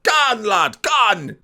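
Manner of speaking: loud, high Geordie urging; broad mock Geordie. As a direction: shout it like a caricature Geordie fan. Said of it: "That, that means Go on".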